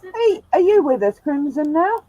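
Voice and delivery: ordinary delivery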